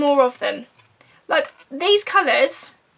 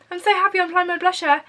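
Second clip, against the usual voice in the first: higher, quicker put-on cheerful voice; fake-smile voice; 4 semitones higher, faster than usual. Vlogger voicing herself mid-fake-smile for the viewers, a touch higher and quicker. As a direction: speak through a fake grin, brightly. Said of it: "do a little fake smile".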